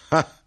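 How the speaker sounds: one high, loud "ha"; knife-grinder's short burst of laughter; acted laugh. A narrator's reading. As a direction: bark out a single high laugh. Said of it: "e lui rise".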